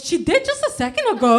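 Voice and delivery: high, loud, quick retort; mock indignant retort. Friend answering her mother about the visitor, mock indignant. Child's baffled retort in retold exchange.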